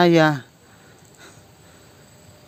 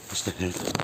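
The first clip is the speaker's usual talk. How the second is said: low, much softer murmured Astagfirullah; muttered sigh; 9 semitones lower, 14 dB quieter, much faster than usual. Real, not acted. After being shooed away by the man: muttered under breath.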